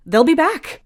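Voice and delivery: high, loud snap; snapping. Acted. Rain snapping at Heath about her parents, high loud snap. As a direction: bite back at him. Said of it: "Rain snaps".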